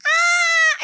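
sudden high piercing scream. Retold or imitated. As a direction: shriek as if jolted awake. She screams waking up.

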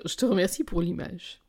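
plain reading voice

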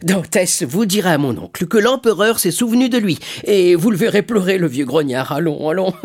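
high, loud delight, easing toward the end; overjoyed. A narrator's reading. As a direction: burst out proudly, then settle into a chuckle. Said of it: "s'écria l'armateur tout joyeux". (joie bruyante qui s'apaise)

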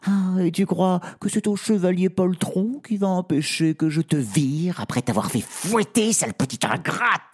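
high, mocking spoiled princess; sneering high royal voice; haughty princess. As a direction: talk down to the servant from above. Princess threatens the servant.

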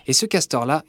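ordinary delivery, nothing marked

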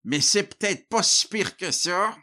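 high dopey coworker voice; dumb voice. Acted. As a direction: say it like a clueless goof. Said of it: "crut bon d'avoir l'air épais".

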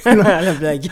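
laughing loud, brushing it off. Real, not acted. Laughs off accusation of selfishness.

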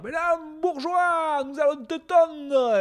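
high, slow put-on revolutionary threat; high slow mock threat; menacing rioter voice. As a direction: threaten the bourgeois slowly. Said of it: "Ils vont me dire".

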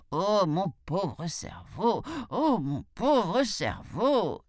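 slow, drawn-out lament; wailing jackal. A narrator's reading. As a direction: moan it, stretching every word. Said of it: "s'écria le chacal". (chacal qui se lamente lentement)

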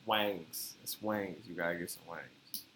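low, soft "wings" drawn toward "wangs"; Southern "wings", the vowel opened to an A; Southern-twang wings. Shown as a demonstration. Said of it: "What is it? Wings?".

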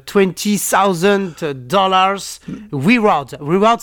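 put-on slow, deliberate English accent on the numbers. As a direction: read the amount like an English speaker. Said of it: "l'accent anglais, évidemment". (accent anglais appuyé et lent)

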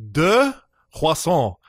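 high, loud first word of Deux croissants; careful French. Retold or imitated. Tourist tries ordering in French, careful high Deux croissants. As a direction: reach up and land the French order.

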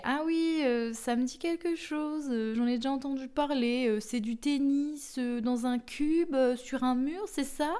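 a playful hammed-up condescending tone. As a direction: imitate people talking down about squash. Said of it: "je le fais un peu de manière, euh, condescendante".

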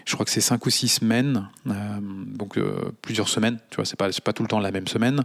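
ordinary delivery

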